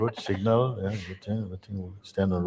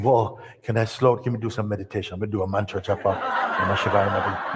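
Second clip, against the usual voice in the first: fast, flustered request; hurried disciple; faster than usual. Retold or imitated. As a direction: rush it like a flustered seeker. Disciple rushes to escape thoughts.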